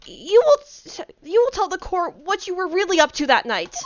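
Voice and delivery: demanding lawyer. Acted. Lawyer part, commanding the witness.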